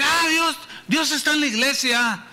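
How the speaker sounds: a teen mocking from the street; mocking retort. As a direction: scoff at the preacher. Boy mocking.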